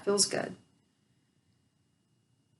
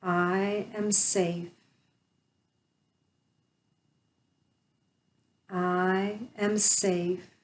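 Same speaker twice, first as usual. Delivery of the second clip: slow affirmation; much slower than usual. Shown as a demonstration. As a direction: let each word settle slowly. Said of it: "I will say them slowly".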